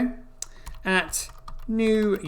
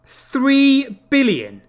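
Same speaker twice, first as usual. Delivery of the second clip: slow, higher, drawn-out disbelief; stunned; 7 semitones higher, 4 dB louder, much slower than usual. Real, not acted. Vlogger seeing a three billion print, slow stunned disbelief. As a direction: let the number sink in slowly.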